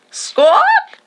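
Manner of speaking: very high, loud bird squawk; the kite squawking with joy, high and loud; joyful squawk. A narrator's reading. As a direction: shriek it like a happy bird.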